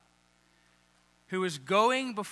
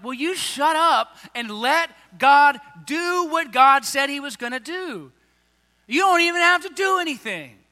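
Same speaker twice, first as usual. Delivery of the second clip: exasperated anger; 6 semitones higher, 7 dB louder than usual. Retold or imitated. Moses scolding the complaining people, loud exasperated anger. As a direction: snap at the grumblers to shut up. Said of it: "like, they're angry".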